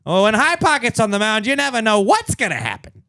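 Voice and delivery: put-on high, loud old-timey radio voice; 1920s Midwestern accent. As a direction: ham up a 1920s baseball fan. Continues old-timey bit.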